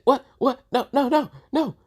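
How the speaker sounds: rapid high-pitched refusals; acted panicked protest. Maiden objects to being called up, high mock panic.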